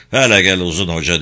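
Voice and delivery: plain reading voice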